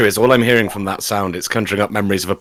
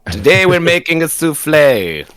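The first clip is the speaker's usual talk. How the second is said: Vincent Price impression; 3 semitones higher, slower than usual. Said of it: "how Vincent Price sounds".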